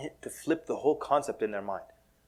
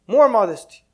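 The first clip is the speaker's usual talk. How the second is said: sheikh's witty comeback; 8 semitones higher, 7 dB louder than usual. Retold or imitated. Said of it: "He goes".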